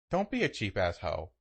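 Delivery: ordinary delivery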